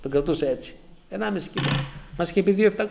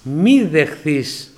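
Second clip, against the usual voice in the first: very slow, louder solemn divine warning; voice of God; 3 semitones lower, 5 dB louder, much slower than usual. Retold or imitated. God warning the sleeping man, slow solemn loud voice. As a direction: stretch each word like a voice from heaven. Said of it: "ακούω πάλι τη φωνή".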